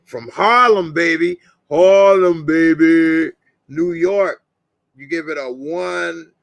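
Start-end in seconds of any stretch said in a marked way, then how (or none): 0.0-4.4 hyped shout-out. Real, not acted.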